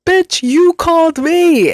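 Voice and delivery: very high, slow, drawn-out comeback; hammed-up outrage, each word stretched; indignant outburst. Callee retelling a retort to the robocall, loudly indignant. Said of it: "I literally said that out loud".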